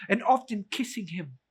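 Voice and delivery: usual speaking voice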